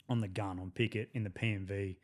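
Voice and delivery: ordinary talk, nothing marked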